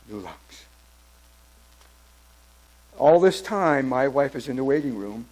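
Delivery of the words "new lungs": hushed. Real, not acted.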